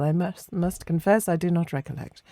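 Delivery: ordinary delivery, nothing marked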